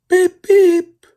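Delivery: very high, loud beeping toot; engine whistle beep. A narrator's reading. Thomas whistle voiced.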